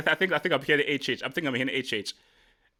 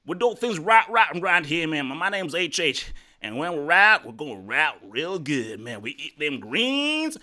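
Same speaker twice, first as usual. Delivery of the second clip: hammed-up Southern accent, climbing higher; 4 semitones higher than usual. Shown as a demonstration. Host showing off in the accent challenge, exaggerated Southern accent. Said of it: "so Southern accents".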